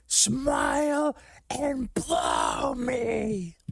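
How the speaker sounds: high, very slow strained yell; Mel Gibson. Retold or imitated. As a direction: drag it out in a cracking high shout. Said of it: "In the words of, uh, Mel Gibson".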